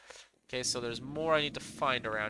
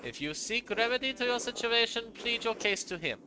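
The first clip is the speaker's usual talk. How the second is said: put-on higher-pitched, stern German-accented monk; 7 semitones higher than usual. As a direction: turn him down in a German accent. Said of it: "I gave him a German accent".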